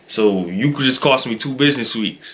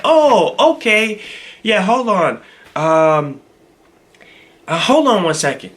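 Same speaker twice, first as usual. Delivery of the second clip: higher-pitched mock realisation, then stalling; imitated stalling clerk; 6 semitones higher, slower than usual. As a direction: mimic her suddenly remembering and playing for time. Voicing the woman stalling.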